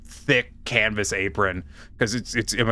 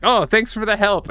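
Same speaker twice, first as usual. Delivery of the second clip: mock customer, pitched much higher; friendly customer; 9 semitones higher, 3 dB louder than usual. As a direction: lightly play the cheerful customer thanking the clerk. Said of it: "and he's like".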